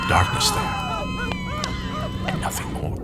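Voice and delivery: hushed breathy whisper into the dark. A narrator's reading. Narrator peering into the dark, whispered.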